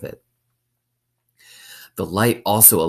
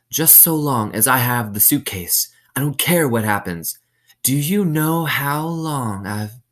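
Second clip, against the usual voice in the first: warden trailing off; 7 semitones higher than usual. Acted. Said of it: "her voice trailed off".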